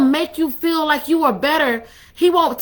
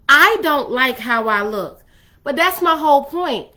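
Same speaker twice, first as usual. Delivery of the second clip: usual speaking voice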